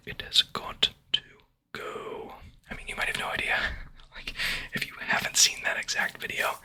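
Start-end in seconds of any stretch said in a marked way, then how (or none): 0.1-2.3 slow, drawn-out whispered mimicry; JoJo imitation. Reenacting an ASMR creator for viewers, slow halting whisper. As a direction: whisper it slowly, holding back the last word. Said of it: "exactly how he says it".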